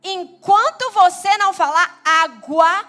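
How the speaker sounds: loud, emphatic strict-parent refusal; acted parental scolding. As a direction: lay down the law to a small child.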